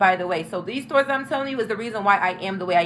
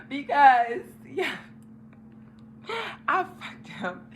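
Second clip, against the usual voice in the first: mock crying confession; 5 semitones higher, 4 dB quieter, slower than usual. Real, not acted. Confessing, crying sound heard.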